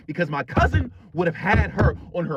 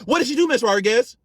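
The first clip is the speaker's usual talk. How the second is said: louder, pushing the question up high; insistent demand; 6 semitones higher, 4 dB louder than usual. Retold or imitated. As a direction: demand the answer from the room. Pressing the principal for answer.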